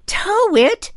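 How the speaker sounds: very high, loud shriek; mother's high screamed question; screaming. A narrator's reading. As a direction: shriek it back in disbelief. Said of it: "she screamed".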